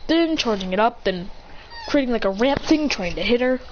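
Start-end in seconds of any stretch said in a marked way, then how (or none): none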